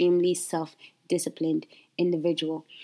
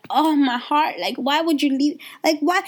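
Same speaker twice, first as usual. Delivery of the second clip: high pleading whine; mock heartbroken; 6 semitones higher than usual. Coach mocking her own heartbreak when clients leave, high pleading. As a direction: play up the hurt of being left. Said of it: "it's like".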